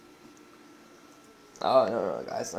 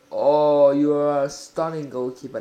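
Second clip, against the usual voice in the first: excited praise; 3 semitones lower, 7 dB louder than usual. Real, not acted. Praising his goalkeeper's save.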